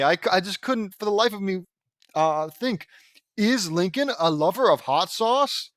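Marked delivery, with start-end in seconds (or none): none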